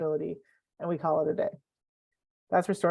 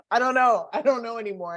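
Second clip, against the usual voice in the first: higher, louder comic exasperation; mock helplessness; 5 semitones higher, 3 dB louder than usual. Author jokes they forget their own book, mock helpless. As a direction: throw up your hands, half laughing.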